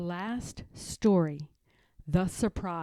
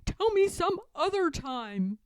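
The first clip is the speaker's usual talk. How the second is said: much higher, louder frantic plea; pleading; 12 semitones higher, 6 dB louder than usual. Acted. George pleads with Martha to end the tale, higher and panicky. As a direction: beg her to quit, panicky. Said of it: "cried George".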